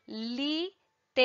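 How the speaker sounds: "literatura" as li, te, ra, tu, ra, each alone; five separate beats in literatura, clear pauses between; split into syllables. Shown as a demonstration. Teacher giving pupils a long example word, syllable by syllable. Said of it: "som que eu vou emitindo".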